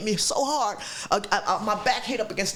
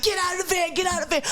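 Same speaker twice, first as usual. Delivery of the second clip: high, frantic plea from the attacker; mock-panicked man pleading; 9 semitones higher, faster than usual. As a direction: beg her frantically to leave. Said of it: "he's like".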